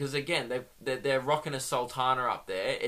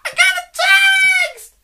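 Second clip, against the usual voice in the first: sudden, very high loud shriek; mock screaming; 14 dB louder, faster than usual. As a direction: burst out screaming as if at the office. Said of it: "they just start screaming".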